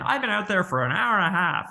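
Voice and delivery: high, huffy complaint; waiting patient huffing at the doctor; mock irate patient. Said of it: "the patient come in".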